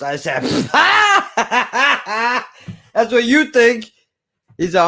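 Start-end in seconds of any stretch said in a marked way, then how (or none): 0.5-2.4 loud, high burst of forced laughter; mocking laugh. Young worker told to scrub toilets, loud mocking laugh. Acting out his scornful laugh. 2.9-3.9 high, loud mocking comeback; cocky retort. Teen worker refusing toilet duty, cocky retort.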